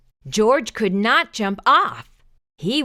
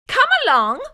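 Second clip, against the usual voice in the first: brisk nurse; 10 semitones higher, 5 dB louder, faster than usual. A narrator's reading. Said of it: "the nurse said".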